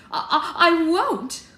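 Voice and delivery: loud, high stammered refusal; crying out. A narrator's reading. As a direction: refuse in a high, shrieky outburst. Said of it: "cried Sarah".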